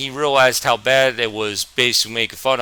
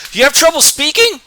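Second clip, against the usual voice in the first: much higher and louder taunt; mocking classmate; 7 dB louder than usual. Classmate taunting new kid's stutter, high loud sneer. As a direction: sneer it like a school bully.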